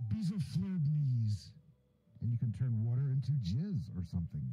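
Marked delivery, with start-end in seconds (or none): 0.1-1.5 slow mock incantation; magic spell. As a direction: cast it like a silly spell. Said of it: "and you say".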